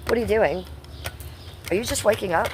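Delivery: normal talking voice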